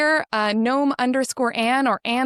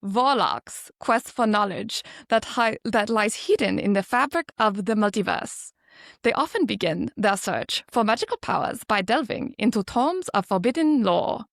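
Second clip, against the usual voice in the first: measured mock-German read, V for W; German accent; 3 semitones lower than usual. Shown as a demonstration. Guest reading a handbook for an accent challenge, German accent. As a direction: put on a fantasy warlock's German accent. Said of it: "so the German, it's V instead of W".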